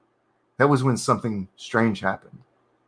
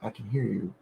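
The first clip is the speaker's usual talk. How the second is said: much softer, held back; quiet retake; 12 dB quieter, faster than usual. Shown as a demonstration. Narrator redoes the line, soft and quiet. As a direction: say it under your breath. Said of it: "There we go".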